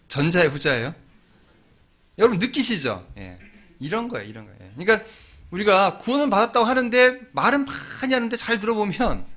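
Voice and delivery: ordinary talk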